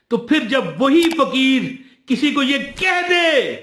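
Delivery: preacher voicing a forceful divine reproof; impassioned rebuke. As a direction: build to a cry, then press the reproach. God's rebuke to Moses, acted.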